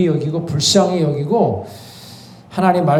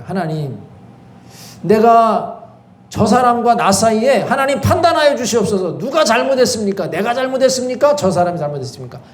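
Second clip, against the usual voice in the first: higher, pleading prayer voice; prayerful plea; 5 semitones higher than usual. Shown as a demonstration. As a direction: lay it before God, pleading. Models praying to God. (간절하게 하나님께 호소하는 기도조)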